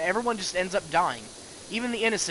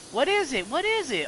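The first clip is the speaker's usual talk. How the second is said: put-on high-pitched excited kid pleading; niños impacientes; 9 semitones higher than usual. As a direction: beg like a curious kid, voice up high. Kids begging dad for story.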